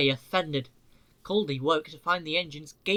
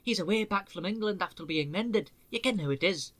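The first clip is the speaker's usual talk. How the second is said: a hint of a Scottish accent, higher; lightly Scottish engine; 4 semitones higher than usual. Donald warning them about the sleeping engine, lightly Scottish. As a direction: hush them in a Scots way.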